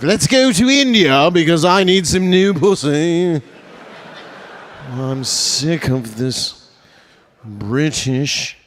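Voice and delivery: high, slow mock-British toff; posh colonizer. British colonizer tells his mates about India, hammed-up. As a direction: ham up a bored colonial officer.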